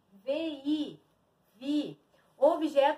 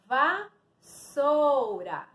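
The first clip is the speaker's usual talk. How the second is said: very slow, split "vassoura"; syllable-by-syllable reveal; much slower than usual. Shown as a demonstration. Teacher revealing a word to pupils, syllable by syllable. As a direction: stretch each syllable for learners.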